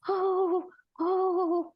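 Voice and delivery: high, wailing mock sobs; mock crying. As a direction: ham up a blubbering cry. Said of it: "she's crying just like a big fool".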